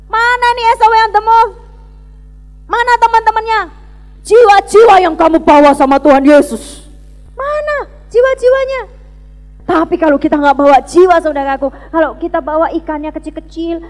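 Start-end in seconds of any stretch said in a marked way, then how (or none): 0.1-9.1 loud, high-pitched angry demand; mock angry scolding. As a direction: bellow it like a furious leader scolding the team. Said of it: "saya marah sama kalian". (bentakan marah dengan suara tinggi)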